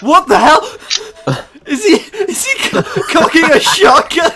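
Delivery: shrieking with laughter; high laughing disbelief; laughing shock. Real, not acted.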